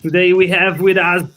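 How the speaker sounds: ordinary delivery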